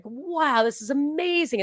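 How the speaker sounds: high-pitched amazed reaction. Retold or imitated. Recalling first reading The Law, amazed. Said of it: "I just couldn't believe it".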